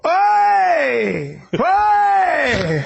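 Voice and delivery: high, long imitated cornerman shouts; corner yell. Showing how a fighter's corner yells at kicks, high shout. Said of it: "their corner will go".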